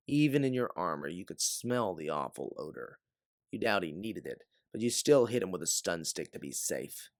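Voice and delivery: ordinary delivery, nothing marked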